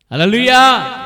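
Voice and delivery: loud, high hallelujah call; preacher's rallying cry; praise shout. Real, not acted. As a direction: ring it out to rouse the congregation. Preacher calls congregation to praise.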